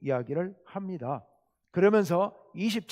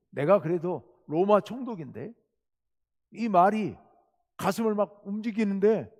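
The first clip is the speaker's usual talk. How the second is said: normal talking voice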